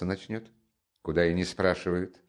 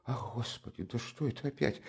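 frightened cry; 5 semitones higher, 9 dB quieter than usual. Acted. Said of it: "вскрикнул ... в совершенном испуге".

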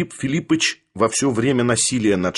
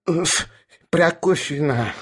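high, hoarse, winded repetition; hoarse, catching breath; 4 semitones higher, slower than usual. A narrator's reading. Sharikov repeats while being choked, hoarse and winded. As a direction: catch air, then croak the name. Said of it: "говорил, перехватывая воздух, охрипший Шариков".

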